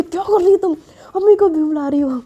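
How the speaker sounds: high, loud alarmed hush-up; panicked. Acted. Hina stopping Kashish from calling mom, high panicked plea. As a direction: stop her before mom hears.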